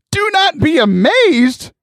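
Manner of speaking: high, drawn-out disbelieving question; incredulous echo. Acted. Listener throws angel's words back, incredulous. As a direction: throw the words back, pitch soaring, slow.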